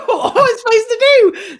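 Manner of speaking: very high, loud squeal of helplessness; hammed-up panicked wail; retold panic. Driver hearing the passenger's confession, hammed-up panic.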